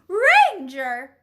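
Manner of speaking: high, loud shrieked call. A narrator's reading. Woman screams for her fighting dog, shrieking. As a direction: shriek the name in alarm. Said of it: "shrieked the woman".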